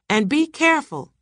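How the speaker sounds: high, calling warning; shouting after someone. A narrator's reading. Cook calls after husband leaving, mild shout. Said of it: "shouted after him".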